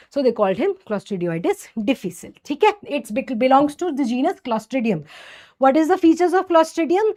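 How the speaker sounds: normal talking voice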